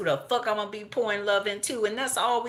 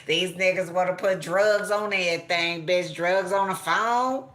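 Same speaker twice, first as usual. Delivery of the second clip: ordinary talk, nothing marked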